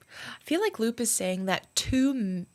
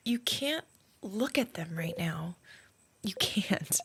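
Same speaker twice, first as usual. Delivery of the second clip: hushed unvoiced warning, slowed; whisper; 5 dB quieter, slower than usual. Loop whispering a warning to Stardust not to look.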